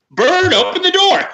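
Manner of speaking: high, loud cliffhanger exclamation; mock-dramatic cry. Co-host parodying the book's cliffhangers, with a high dramatic cry. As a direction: blurt it out like a hammy chapter ending.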